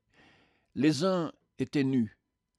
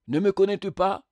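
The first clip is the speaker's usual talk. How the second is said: higher, louder cried question; calling out; 8 semitones higher, 7 dB louder than usual. A narrator's reading. Radiant being to the spectre, calling out. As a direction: cry it out to be recognised. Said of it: "lui cria ce dernier".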